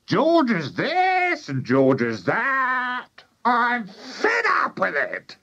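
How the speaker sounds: high, drawn-out granny rant; high-pitched indignant old woman; shouting Grandma. A narrator's reading. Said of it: "shouted Grandma".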